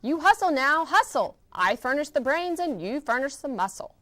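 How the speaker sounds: loud, high barking orders; bossy bug. Acted. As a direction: drive him on like a slave driver. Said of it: "just sat there and bossed him".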